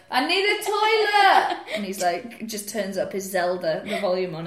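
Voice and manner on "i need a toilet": high, loud, slow shout of need; mock calling out. Girlfriend calls to boyfriend downstairs, mock desperate shout. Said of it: "I'll be like".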